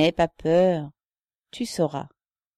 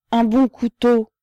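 loud, higher, drawn-out insistence; insistent; 7 semitones higher, 10 dB louder than usual. A narrator's reading. Pockmarked man pushing his offer of a knife, insistent. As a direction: press the point slowly with raised voice. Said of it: "insista le grêlé".

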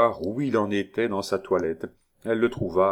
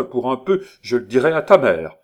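loud, raised telling-off; scolding threat; 5 semitones higher, 8 dB louder than usual. Acted. Neighbour threatening to tell his mother, scolding. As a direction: build to a loud warning.

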